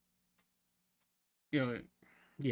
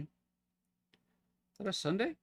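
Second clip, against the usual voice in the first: drawn-out rising, puzzled question; slow puzzled question; 6 semitones higher, 4 dB quieter, much slower than usual. Real, not acted. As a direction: stretch it out, unsure. Rechecks date, drawn out.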